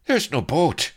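loud, high alarmed cry; loud high dismayed cry; cried. A narrator's reading. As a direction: shout the bad news. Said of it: "he cried".

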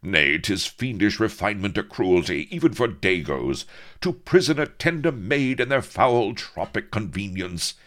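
high, loud, lofty lament; anguished outrage. A narrator's reading. Avery deploring the lady's prison, anguished and lofty. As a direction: declaim it as a wounded hero. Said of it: "cried Avery in anguish".

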